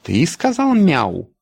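slow, stern and pressing; stern question. A narrator's reading. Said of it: "строго спросил". (строгий допрос)

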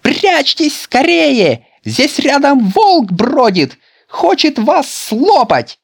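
very high, loud, slow alarm; frantic shout. A narrator's reading. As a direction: scream a warning from the doorway. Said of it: "закричал". (Петя кричит с порога, очень громко и высоко)

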